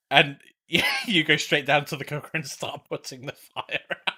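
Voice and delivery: usual speaking voice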